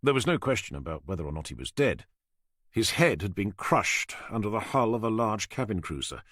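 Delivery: usual speaking voice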